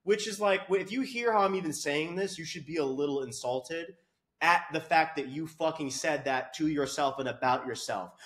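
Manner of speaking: ordinary delivery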